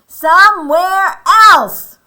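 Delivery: slow, high storybook bellow; very loud, angry. Acted. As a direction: shout each word as a furious Elephant. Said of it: "he says it so loudly".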